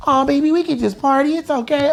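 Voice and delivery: high, coaxing woman's voice. Retold or imitated. Tila sweet-talking him in the car, high and coaxing.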